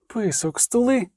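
understated hiss from an archer; hissed order. A narrator's reading. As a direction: hiss it to shut someone up. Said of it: "просичала лучниця".

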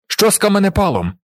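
high-pitched narrated shout; shouted question. A narrator's reading. Newcomer asks about the weapon, shouting. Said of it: "закричав один із новоприбулих".

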